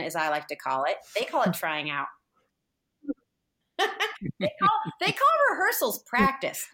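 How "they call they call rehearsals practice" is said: high-pitched, laughing through the words. Real, not acted. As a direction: crack up at your own joke while speaking. Joking about baseball terms, laughing.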